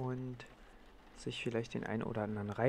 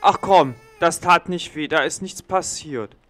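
brushing it off; 5 semitones higher, 13 dB louder than usual. Acted. Brushing off baby's fall.

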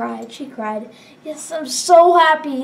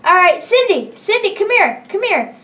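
calling out; 5 semitones higher, 4 dB louder than usual. Acted. Calling Cindy over in game.

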